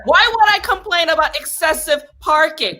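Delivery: high-pitched, incredulous rant; exasperated bafflement. Real, not acted. Caller ranting to listeners about the parking mishearing, exasperated bafflement. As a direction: keep hammering the question, high and baffled.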